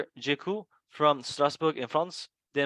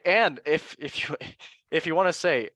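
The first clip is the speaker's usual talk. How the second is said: ordinary talk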